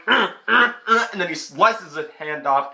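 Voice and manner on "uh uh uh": loud, high fighting grunts; hammed-up combat grunts; effort grunts. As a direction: grunt with each sword blow. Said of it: "just like".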